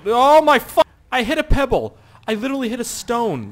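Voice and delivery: high loud disbelief; startled outcry. Real, not acted. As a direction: shout in shock. Hitting a pebble.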